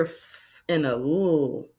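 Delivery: "f" and "l" as separate held sounds; letter sounds. Shown as a demonstration. Teacher splitting the first blend, sounds held apart. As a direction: draw out "f", then "l", as pure sounds. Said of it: "I hear".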